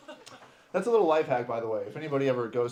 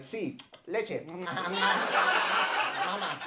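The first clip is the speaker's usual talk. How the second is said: put-on higher little-kid voice in Spanish; little boy; 5 semitones higher, much slower than usual. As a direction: ask like a small child wanting Mama. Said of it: "I speak like a little boy".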